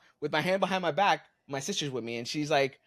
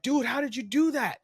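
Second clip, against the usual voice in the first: put-on kids; 10 semitones higher than usual. Kids pointing at broken arm.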